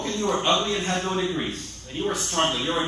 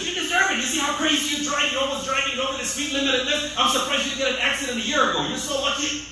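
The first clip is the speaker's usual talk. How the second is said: scornful lecturing; 6 semitones higher, faster than usual. Retold or imitated. Mocking an accident victim.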